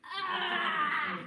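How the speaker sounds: sudden shout while pushing; demo shout. Shown as a demonstration. Teacher showing the push, a sudden loud shout. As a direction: let out a loud push yell. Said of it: "get in there and you just".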